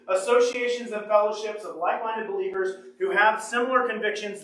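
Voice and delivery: ordinary talk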